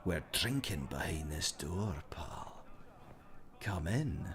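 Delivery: put-on conspiratorial whisper in accent. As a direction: lean in and whisper it like a secret. Sneaking in game.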